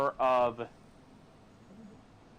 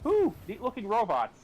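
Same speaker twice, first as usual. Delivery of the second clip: put-on childlike wonder; 8 semitones higher than usual. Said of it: "They were just".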